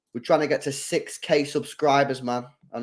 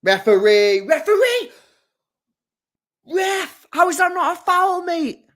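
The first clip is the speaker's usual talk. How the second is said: much higher, loud, furious shouts at the ref; outraged appeal; 13 semitones higher, 6 dB louder, slower than usual. Real, not acted. Fan screaming at the referee over a foul, outraged. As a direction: bellow at the referee in disbelief.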